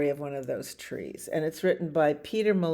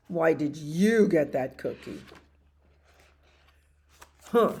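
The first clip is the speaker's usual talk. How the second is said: demanding pigeon; 6 dB louder than usual. Acted.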